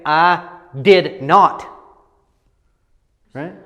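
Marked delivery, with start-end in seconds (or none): 0.0-1.7 slow, stressed I did not; deliberate emphasis on I did not; plain emphasis. Shown as a demonstration. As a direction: lean on each word of I did not. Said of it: "emphasis would just be saying".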